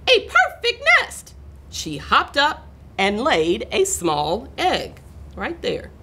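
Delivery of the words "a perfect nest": very high, delighted hen voice; excited chicken cry. A narrator's reading. Chicken admiring the nest, high excited cry. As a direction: squeal it as a thrilled chicken. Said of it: "she cried".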